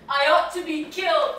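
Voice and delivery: despairing outburst of guilt; despairing cry. Acted. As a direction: wail it out in despair. Self-loathing outburst.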